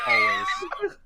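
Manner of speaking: high shouted whine. Real, not acted. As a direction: squeal it in protest. Joking cry of protest.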